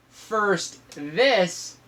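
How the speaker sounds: ordinary delivery, nothing marked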